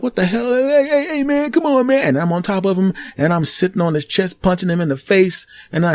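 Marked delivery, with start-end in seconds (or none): 0.0-2.1 panicked bully. Retold or imitated. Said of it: "he's like".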